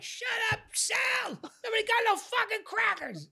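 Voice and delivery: high, loud, slow furious shout; retold angry yell. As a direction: yell at Sal to shut up. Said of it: "Now I'm yelling".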